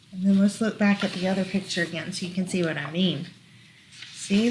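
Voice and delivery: plain reading voice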